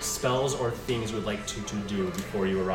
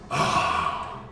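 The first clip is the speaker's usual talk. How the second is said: dragon voice; 10 dB louder than usual. Acted. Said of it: "the dragon's booming voice speaks".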